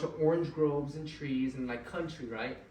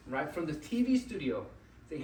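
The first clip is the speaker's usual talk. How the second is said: ordinary talk, nothing marked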